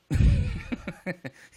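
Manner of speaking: loud, high-pitched cackle; burst of laughter. Real, not acted. Streamer cracking up over admitting Bob won't believe them, loud high laugh. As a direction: explode into a long high laugh.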